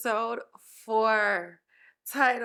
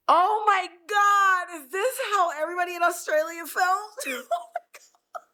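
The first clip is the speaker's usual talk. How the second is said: real crying, pitched way up, half laughing; laughing through tears; 8 semitones higher, 4 dB louder than usual. Real, not acted. Host overwhelmed by the saddest episode, wailing tearfully.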